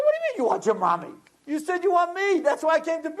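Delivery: high, rising mock protest; worked-up, incredulous retort, pitch climbing steeply; exasperated father. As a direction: act out an indignant dad climbing toward a shout. Exasperated father acted in story.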